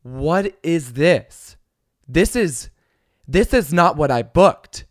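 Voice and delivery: entitled passenger sneering at the steward; disdainful passenger. Retold or imitated. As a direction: drag it out with disgust. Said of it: "She's like".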